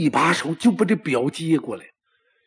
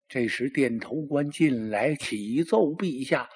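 usual speaking voice

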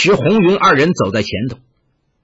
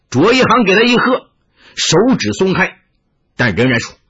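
ordinary delivery

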